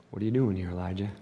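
very soft, hushed question; gentle whisper voice. Acted. Pastor voicing God's gentle question to Elijah, hushed. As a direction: ask it gently, barely above a breath. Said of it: "Sound of a gentle whisper".

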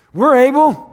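high, loud, overeager "we're able"; eager mock disciples. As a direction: play the clueless disciples shouting yes.

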